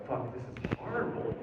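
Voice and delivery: hushed, breathy dismay; soft, mostly unvoiced acted dread; horrified aside. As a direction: mutter it to yourself, appalled. Inner reaction on finding his father.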